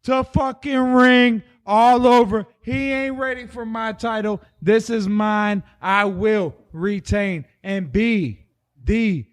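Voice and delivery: strut it out like Ric Flair; Ric Flair impression. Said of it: "go into straight ass Ric Flair".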